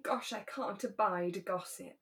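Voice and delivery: ordinary delivery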